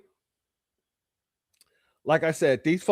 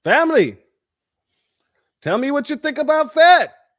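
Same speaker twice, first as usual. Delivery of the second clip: higher and louder, calling out to the chat; excited call; 7 semitones higher, 6 dB louder than usual. Real, not acted. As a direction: hype up the viewers with a raised voice.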